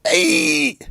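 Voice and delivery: high sudden hey; surprised shout. Real, not acted. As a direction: yelp in delight. Listener cries out at movie line.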